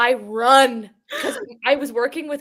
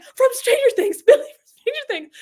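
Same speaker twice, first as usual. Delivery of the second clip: excited squeal; 13 semitones higher, 6 dB louder than usual. Retold or imitated. Starstruck explaining who arrived.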